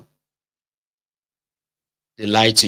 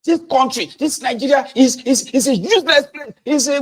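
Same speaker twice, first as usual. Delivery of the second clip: much higher, loud and flustered rant; angry trader; 13 semitones higher, 4 dB louder than usual. Acted. A cheated trader yelling at the interviewer, furious. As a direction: sputter it out in fury. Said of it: "I said".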